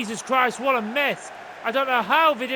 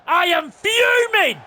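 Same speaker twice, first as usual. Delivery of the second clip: slow, loud, raised-pitch angry outburst; fuming; 4 semitones higher, 6 dB louder than usual. Real, not acted. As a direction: stretch it into a furious shout.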